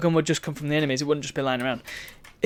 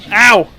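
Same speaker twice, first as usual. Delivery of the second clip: ow; 5 semitones higher, 12 dB louder than usual. Real, not acted. Kicked in the face.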